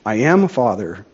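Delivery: plain reading voice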